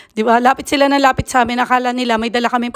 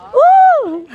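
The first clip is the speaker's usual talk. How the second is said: sudden high, loud woo as the swing rises; excited whoop; 10 dB louder than usual. Real, not acted. Mom on the swing, whooping with delight. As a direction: let out a thrilled whoop.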